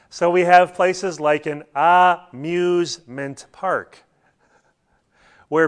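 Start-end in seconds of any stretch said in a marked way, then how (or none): 1.8-3.5 "amusement" split as a-muse-ment; "amusement" pulled apart into its parts; word broken down. Shown as a demonstration. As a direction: say "amusement" slowly, syllable by syllable.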